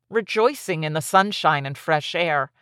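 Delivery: plain reading voice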